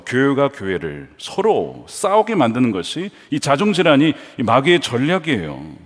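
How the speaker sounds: ordinary talk, nothing marked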